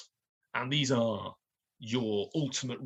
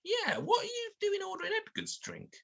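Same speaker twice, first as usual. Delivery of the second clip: very high barmaid voice; squeaky, nosy barmaid. Acted.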